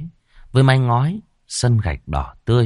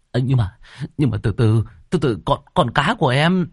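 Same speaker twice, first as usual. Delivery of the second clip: higher, stumbling and pleading; flustered stammer; 6 semitones higher than usual. Acted. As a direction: stammer out a panicked excuse. (lắp bắp hoảng hốt)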